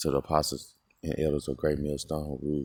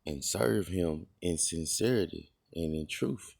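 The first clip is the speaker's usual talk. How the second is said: solemn scripture reading; 3 dB quieter than usual. Acted. Reads scripture passage aloud.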